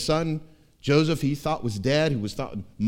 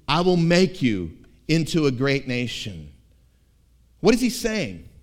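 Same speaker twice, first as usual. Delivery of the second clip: ordinary delivery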